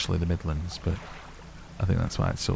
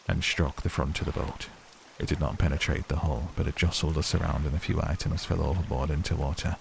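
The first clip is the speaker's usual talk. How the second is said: a lower, even read of a ship's log; diary reading; 3 semitones lower than usual. Acted. Reading the game's diary entry to viewers, measured and low. Reads a diary aloud in game.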